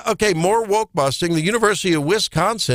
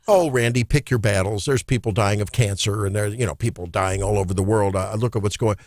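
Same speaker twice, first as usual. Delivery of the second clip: imagined objector; 8 semitones lower than usual. Acted. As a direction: lightly voice a critic brushing off the complaint. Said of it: "And you could say".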